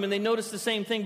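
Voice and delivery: normal talking voice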